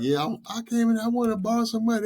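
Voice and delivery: high, sheepish borrower's voice; high-pitched imitation of a borrower begging; mock customer. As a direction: play the nervous customer asking for a loan. Imitating hopeful customer at bank.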